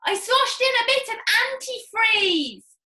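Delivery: shout. Acted. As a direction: shout after the running father. George shouting after.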